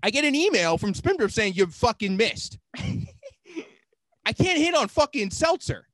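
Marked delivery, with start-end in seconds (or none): none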